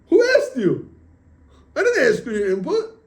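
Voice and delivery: high, loud retort; storyteller's outraged put-down; indignant rebuke. Retold or imitated. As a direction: act out snapping back at a tempter. Retold rebuke of the Yetzer Hara.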